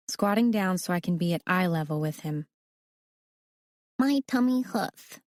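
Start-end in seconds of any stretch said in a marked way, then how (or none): 4.0-5.2 put-on higher, slow, whiny little boy; a sick little kid complaining; lightly voiced small boy, mildly teary; little boy with a tummy ache. A narrator's reading. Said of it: "comes downstairs crying".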